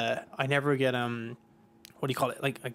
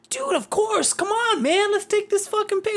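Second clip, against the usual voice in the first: friendly rock star; 4 dB louder than usual. Retold or imitated. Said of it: "he goes".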